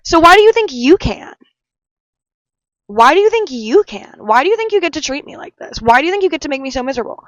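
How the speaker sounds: high, loud, accusing questions; angry confrontation. Pretend. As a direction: demand answers from the boy who hurt you. Confronting the boy who hurt her.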